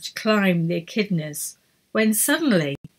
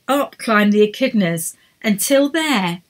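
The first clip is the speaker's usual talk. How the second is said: usual speaking voice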